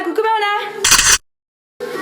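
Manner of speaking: high, slow, drawn-out coucou; sing-song greeting. Real, not acted. Greeting a little girl.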